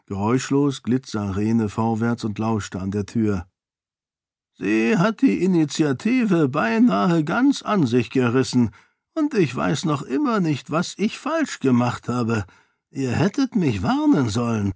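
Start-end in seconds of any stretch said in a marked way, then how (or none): 4.6-14.7 higher, lightly voiced old lord; a grudging complaint; grumbling duke. A narrator's reading. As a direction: duke admitting defeat to Kiyin.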